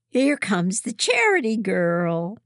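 high, loud mocking call; taunting call. A narrator's reading. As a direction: jeer at the new girl. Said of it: "one calls".

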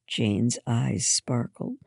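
usual speaking voice

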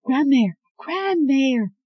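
high, loud desperate cries of a name; frantic calling. Acted. Scarlet calling loudly through the cell bars for Grandmere. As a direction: call out into the dark for her grandmother.

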